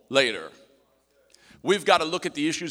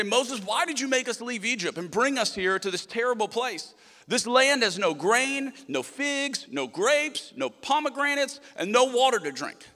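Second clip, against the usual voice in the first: complaining Israelites; 8 semitones higher than usual. Retold or imitated. Israelites whining at Moses in the desert, high and aggrieved. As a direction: moan every item like a fed-up crowd. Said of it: "they go to Moses and they say".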